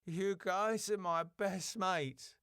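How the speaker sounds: slow, faint, high muffled voice; muffled and sentimental, out of view. Acted. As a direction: speak softly from inside a closed bin. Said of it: "[name], muffled, out of view".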